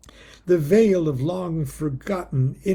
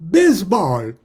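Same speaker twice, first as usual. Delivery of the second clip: loud excited cries of Baseball, higher; mock foreign shout; 4 semitones higher, 8 dB louder than usual. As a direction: shout it like a bishop who speaks no English. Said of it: "I'd slap my knee and go".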